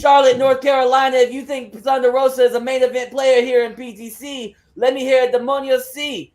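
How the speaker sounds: loud, high-pitched hype; rallying shout to crowd. Acted. Wrestler demands a chant from Charlotte, hyped and shouting. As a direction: project to the whole arena for a chant.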